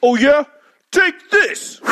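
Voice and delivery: high, loud attack yell; battle cry. Acted. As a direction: shout it as you strike.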